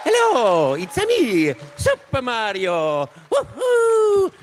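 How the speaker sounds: high, bright Italian plumber cheer; bouncy cartoon plumber shout; Mario voice. Mario voice at fan event.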